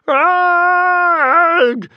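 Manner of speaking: very high, very loud shriek of shock; screech. A narrator's reading. Gobber jumps in terror at the corpse, screeching. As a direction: screech in sheer fright. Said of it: "screeched Gobber the Belch".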